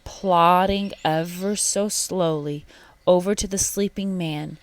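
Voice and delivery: plain reading voice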